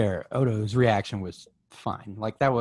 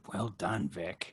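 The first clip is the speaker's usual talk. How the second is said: quiet; 11 dB quieter, much slower than usual. Retold or imitated. Odo voiced, quiet.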